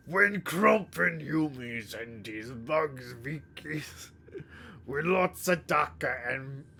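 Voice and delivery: put-on deliberately terrible Cockney orc accent, slow; slow, gleeful Cockney ork boasting; bad Cockney orc. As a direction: ham up a Warhammer ork in awful Cockney. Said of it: "the worst Cockney accent I can do".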